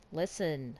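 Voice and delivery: usual speaking voice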